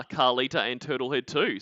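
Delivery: ordinary talk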